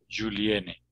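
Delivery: "Juliene" with a voiced J; Portuguese J. Shown as a demonstration. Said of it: "como si fuera en portugués".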